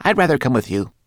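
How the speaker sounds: quickly change his mind; hasty Twigleg. A narrator's reading. Twigleg hastily decides to go with Ben, high and quick. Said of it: "said Twigleg hastily".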